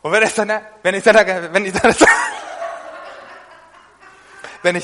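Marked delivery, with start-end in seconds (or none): 2.0-3.4 very high breathless laugh; high silent laugh. Real, not acted. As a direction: wheeze with laughter. Laughing after tongues.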